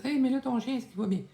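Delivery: hammed-up fretful viewer voice; imitating worried viewers. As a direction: squeak it like an anxious fan asking about the dog. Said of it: "Vous me dites".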